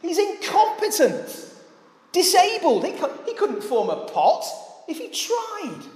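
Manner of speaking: high-pitched, incredulous scoffing; acted-out insolent jeering in a high voice; rude mockery. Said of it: "Even ruder shall the handiwork say".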